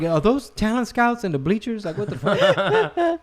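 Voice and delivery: high, laughing incredulous question; laughing disbelief. Retold or imitated. Dad outplayed by a big kid, laughing disbelief. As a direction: gasp it out in amused disbelief. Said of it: "I'm looking around like".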